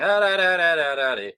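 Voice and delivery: babbled noise to block out spoilers; loud "da da da". Retold or imitated. Friends avoiding spoilers outside cinema, loud babbling. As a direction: drown out others with high nonsense syllables. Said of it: "we were going".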